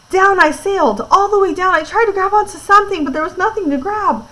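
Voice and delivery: high-pitched, urgent storytelling; alarmed narration. A narrator's reading. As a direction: read it as if tumbling down, pitch held high. Narrating a sudden frightening fall.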